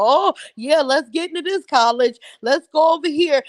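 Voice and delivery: a hint of a gushing mom; mocking imitation. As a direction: ham up her fake excitement. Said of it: "She tried to act like".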